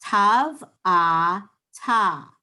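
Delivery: teacher spelling out tav, ah, ta for the class; sounding out letters. Shown as a demonstration. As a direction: sound out each part, tav then ah then ta. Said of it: "So we're gonna say".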